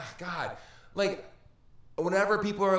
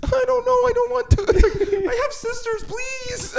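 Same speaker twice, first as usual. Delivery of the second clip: squeaky mock plea through laughter; hammed-up pleading between laughs; high laughing plea; 8 dB louder than usual. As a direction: beg in a high giggly voice.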